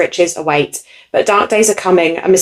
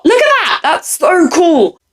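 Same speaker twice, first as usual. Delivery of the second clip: very high, slow, stretched excitement; thrilled; 10 semitones higher, slower than usual. Real, not acted. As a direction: show it off like a treasure. Showing the indie book.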